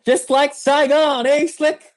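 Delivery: hammed-up movie line, pitched high; film quote. Quoting a favourite film line to a co-host, hammed-up impression.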